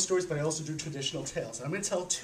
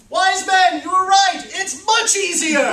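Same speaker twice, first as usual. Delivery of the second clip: very high and much louder, slow at the end; triumphant shout; 12 semitones higher, 13 dB louder, slower than usual. Acted. Workers shouting back to the wise men, high and loud. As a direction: shout the praise up the hill. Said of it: "they rolled it down".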